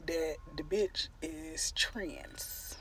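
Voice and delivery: hushed secret whisper. Retold or imitated. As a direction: breathe it out like gossip. Revealing a secret discovery.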